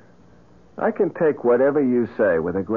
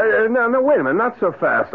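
alarmed protest; 10 semitones higher, faster than usual. Acted. Johnny alarmed, camera taken.

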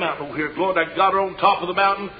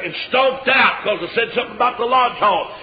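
normal talking voice

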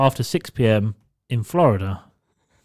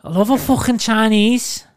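high, loud mock Scouse woman; Scouse accent; 10 semitones higher, 5 dB louder than usual. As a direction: send up a Liverpool girl's Scouse, pitched high. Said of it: "one of them accents".

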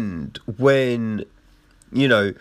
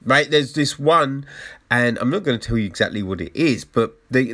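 normal talking voice

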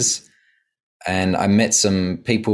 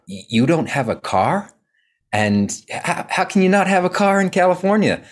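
mock Valley-girl California accent, pitched up; bad Californian accent; 10 semitones higher than usual. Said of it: "That's my bad, uh, Californian accent".

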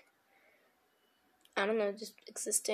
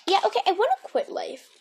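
loud, high, fed-up outburst; exasperated; 8 semitones higher, 9 dB louder than usual. Real, not acted. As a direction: throw up your hands, loudly.

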